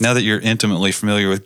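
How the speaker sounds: ordinary talk, nothing marked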